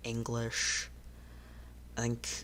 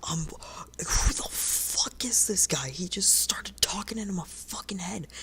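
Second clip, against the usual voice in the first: panicked whisper; 8 semitones higher than usual. Acted. Jeff panics at a telepathic voice.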